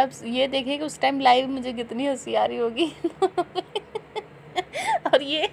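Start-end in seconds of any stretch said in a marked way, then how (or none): 3.0-4.2 high soft giggle; a soft giggle breaking out. Real, not acted. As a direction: giggle at the memory. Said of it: "मुझे इतनी हंसी आ रही है".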